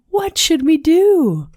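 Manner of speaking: lightly voiced worried kids calling out; children crying out. A narrator's reading. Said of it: "The children cried out".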